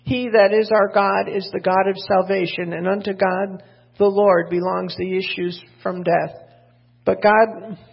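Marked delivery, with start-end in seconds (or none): 0.0-6.3 louder, measured Bible reading; scripture reading. Acted. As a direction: proclaim the verses to the church. Reads scripture aloud to congregation.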